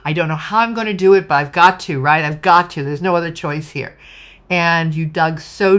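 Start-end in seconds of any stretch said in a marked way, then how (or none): 0.0-4.0 determined pep talk. Retold or imitated. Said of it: "But you were like".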